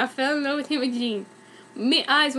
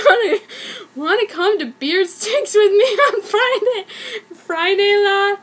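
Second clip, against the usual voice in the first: laughing; 7 semitones higher, 4 dB louder than usual. Real, not acted. Rereads the line after laughing.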